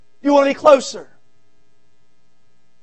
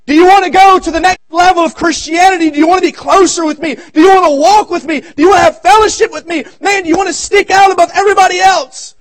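loud, high preaching build, shouting the questions; preaching at full force; 11 semitones higher, 13 dB louder than usual. Acted. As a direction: press the congregation like a revival preacher. Pastor preaching to congregation.

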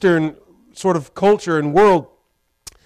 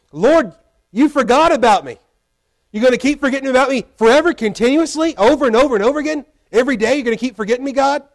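higher, louder, rushed lament; anguished plea; 7 semitones higher, 4 dB louder, faster than usual. Retold or imitated. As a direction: pour it out fast like a man who feels abandoned. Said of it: "He goes".

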